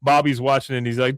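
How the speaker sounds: ordinary talk, nothing marked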